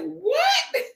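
a squeaky high "What?" of disbelief; surprised what. Retold or imitated. Retells her own surprise.

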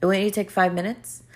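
usual speaking voice

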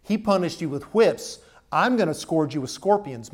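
loud, hard-driven threat; harsh threat. Acted. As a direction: throw it at them like a warning. Young men urge a harsh threat.

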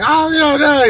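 very high imitated kid protest; protesting child. Son objecting to his dad, high mock protest.

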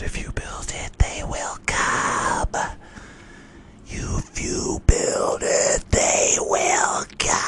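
slow, voiceless ghostly whisper; haunt him from the corn; eerie whisper. Acted. The voice in his head, a slow eerie whisper. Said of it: "a voice in his head".

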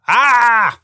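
high-pitched cry of pain, acted; storyteller's contained "Ah!" scream; mock scream. A narrator's reading. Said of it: "I screamed".

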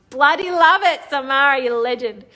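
seru, lebih tinggi dan jauh lebih keras; thrilled. Real, not acted. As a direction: sorakan gembira untuk temannya. Delighted reaction to a comment.

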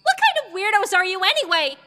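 high-pitched, rude shout; loud, bratty outburst. A narrator's reading. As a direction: fling the question out indignantly. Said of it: "the girl shouted".

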